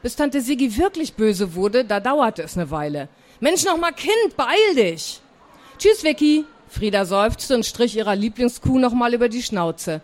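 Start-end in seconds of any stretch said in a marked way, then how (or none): 3.4-5.2 higher-pitched, impatient outburst; exasperated call. Acted. Aunt loses patience with her dawdling niece, exasperated. Aunt hurrying child, getting crosser. 5.8-6.4 higher-pitched little farewell call; child's goodbye. Acted. Girl takes leave of her favourite cow, calling goodbye.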